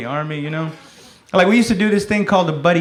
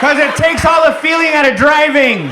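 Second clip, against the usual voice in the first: high, loud protest; shouted excuse; 10 semitones higher, 5 dB louder than usual. Acted. Driver defending no seatbelt, high comic shout. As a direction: yell it in comic indignation.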